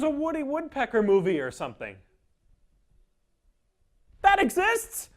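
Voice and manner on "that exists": much higher, louder incredulous question; can't believe it's real; shocked rising that exists; disbelief. Real, not acted. Shocked at Woody Woodpecker movie.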